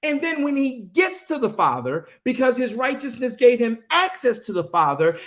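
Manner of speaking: high-pitched fervent preaching; impassioned preaching. Real, not acted. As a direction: preach it with full fire. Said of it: "I'm very passionate about this".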